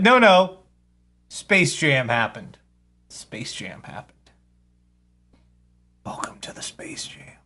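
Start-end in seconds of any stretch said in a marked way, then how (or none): 3.1-4.3 hushed repeat. Acted. Repeats the phrase, then whispers. 6.0-7.4 breathy whispered welcome; hushed mock-ominous welcome; eerie whisper.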